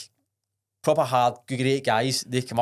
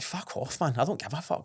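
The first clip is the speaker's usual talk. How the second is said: a breathy, much softer mock brush-off; dismissive hushed reply; 7 dB quieter than usual. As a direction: toss it away under your breath. Dismissing work, retold reply.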